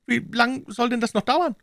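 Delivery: very high put-on character voice; impatient captive. A narrator's reading. Captive Jones complains in character voice. (ungeduldiger Gefangener mit hoher Stimme)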